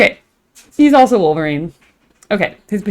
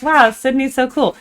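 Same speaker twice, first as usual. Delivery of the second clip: play-acted amazement; 8 semitones higher, 4 dB louder than usual. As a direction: gush like a wowed tourist. Acts out future excited live reaction.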